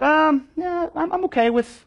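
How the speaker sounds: lifted, hesitant mock student. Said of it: "you guys might be like".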